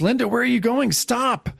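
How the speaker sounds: lightly voiced old man crying out; calling after her. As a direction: reader voicing an old man calling stop. Old man calls after Linda.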